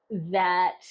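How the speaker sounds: ordinary talk, nothing marked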